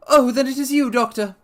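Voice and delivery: very high, loud relieved squeak; nervous patient relieved to hear the doctor. Acted. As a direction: sigh with relief in a reedy voice. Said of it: "with a great heave of relief".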